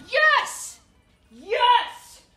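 loud victory shout; triumphant yell. Real, not acted. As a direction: cheer at winning. Winning the race.

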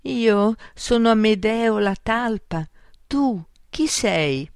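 a hint of a trembling storybook mole; Amedeo, tremulous. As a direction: introduce yourself haltingly as a nervous creature. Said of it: "con voce tremula e bassa".

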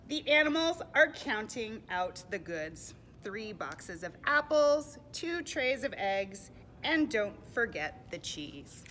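put-on loud, slow read-aloud for children; deliberate, projected picture-book reading; storybook narration. A narrator's reading. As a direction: count out each item slowly for little listeners.

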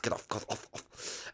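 whispered get off; whispered shoo. Acted. Luigi whispers at Blimpy to get off his bed. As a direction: hiss it under the breath.

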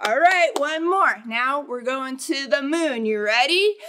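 plain reading voice

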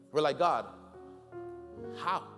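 normal talking voice

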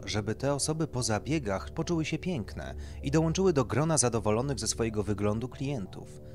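ordinary delivery

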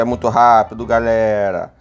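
ordinary delivery

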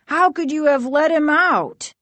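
high, indignant outburst; exclaiming. A narrator's reading. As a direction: exclaim in high-pitched dismay. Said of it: "the other Doctor Brewer exclaimed".

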